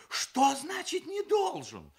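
high, loud, alarmed question; slow it down into a startled high cry; frightened bride. A narrator's reading. Startled bride questioning her father's wording, frightened. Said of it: "испугалась невеста".

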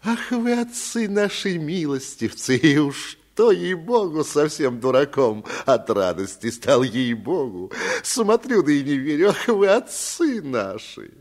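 high, loud tearful sing-song gushing; tipsy steward singing his master's praise; sing-song fawning. A narrator's reading. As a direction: wail the praise even harder. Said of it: "запел пуще прежнего".

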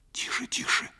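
soft, breath-only shushing; urgent little whisper; whispered hushing. A narrator's reading. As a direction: hush them in a whisper. Mother anxiously hushing her son.

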